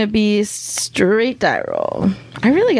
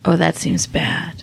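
whisper; 5 semitones lower, slower than usual. Real, not acted. Player reacts to the danger.

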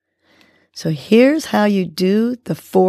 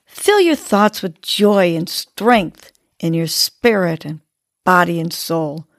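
ordinary talk

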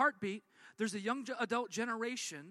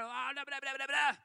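mock cursing; 4 semitones higher than usual. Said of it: "like, cursing at her".

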